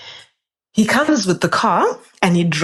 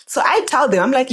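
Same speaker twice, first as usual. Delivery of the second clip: ordinary delivery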